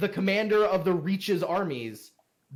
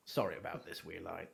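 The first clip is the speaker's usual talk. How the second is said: low, much softer apology; embarrassed mutter; 12 semitones lower, 12 dB quieter than usual. Acted. Father apologizing to Teedrick for his son, muttered low. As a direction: mutter the apology under your breath. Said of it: "he goes".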